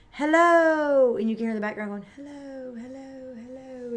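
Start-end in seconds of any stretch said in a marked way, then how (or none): 0.1-1.2 loud, high call. Shown as a demonstration. Teacher shows kids how to shout for an echo, loud. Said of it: "when you go". 2.2-4.0 much softer, fading repeats; echo. Shown as a demonstration. Teacher imitates the echo for the class, faint. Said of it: "it's your echo".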